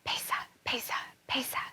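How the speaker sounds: breathy unvoiced whisper of a secret. Wife whispering her plan to Anansi, hushed whisper. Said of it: "And she whispered".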